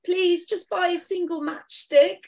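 high, slow begging urchin voice; Victorian urchin. Shown as a demonstration. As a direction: plead like a matchstick seller. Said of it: "like a little Victorian urchin".

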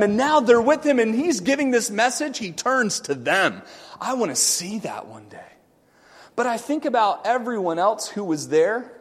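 ordinary delivery

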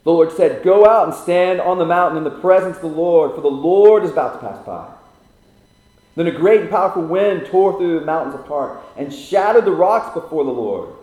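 loud, high, declaiming; scripture reading. Acted. Preacher reading the storm passage aloud to the congregation. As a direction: proclaim the passage to the hall.

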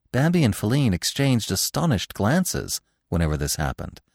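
ordinary delivery

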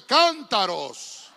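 cántaros shouted out slow, falling at the end. Shown as a demonstration. Preacher pushes the church to say it strongly, loud. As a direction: say cántaros fully loud now. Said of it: "dígalo fuerte".

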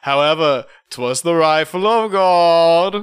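slow, theatrical declamation; mock-grand orator. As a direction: proclaim it like a solemn revelation. Mock dramatic testimony as risen Kennedy.

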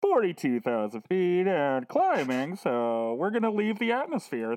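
calm airline captain announcement; pilot voice. Acted. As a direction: speak like a pilot on the intercom. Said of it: "pilot voice".

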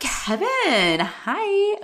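high, swooning mock greeting; flirty. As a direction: coo it at a crush, pitch leaping up. Greeting the hot nurse, retold.

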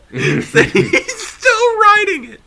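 very high squeal of amusement; helpless laughter. Real, not acted. Telling friend about uncle stuck upright, cracking up.

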